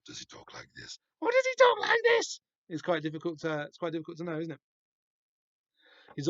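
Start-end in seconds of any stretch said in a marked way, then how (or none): 0.1-1.0 breathy whisper, almost no voice; whispered villain voice. Shown as a demonstration. Reader testing a villain voice, whispering. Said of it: "How does the God of the Razor talk?". 1.2-2.4 much higher and louder falsetto; squeaky high villain voice. Shown as a demonstration. Reader testing a villain voice, squeaky falsetto. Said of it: "How does the God of the Razor talk?".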